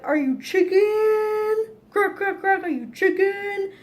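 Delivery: high, loud playground taunt; mock taunting yell. Boy taunting her at the frozen lake, high mocking yell. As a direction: jeer like a bratty older boy calling her chicken. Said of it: "And then he yelled".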